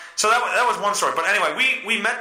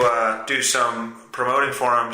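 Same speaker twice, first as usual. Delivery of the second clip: ordinary talk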